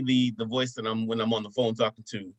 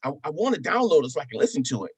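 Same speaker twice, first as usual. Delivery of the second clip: higher-pitched imitation of a kid; eager son; 5 semitones higher than usual. Son who is his biggest fan, higher kid imitation. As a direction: ask dad like an eager child. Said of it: "he's like".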